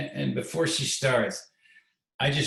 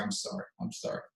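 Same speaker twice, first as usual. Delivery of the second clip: soft, hushed imitation of a tearful apology; 10 semitones higher, 9 dB quieter than usual. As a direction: quietly mimic her apologizing through tears. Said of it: "coming out and be crying".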